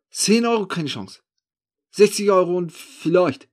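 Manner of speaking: loud, high opening retort; scornful haggling. Acted. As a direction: scoff at the offer and counter it. Thief rejects the offer.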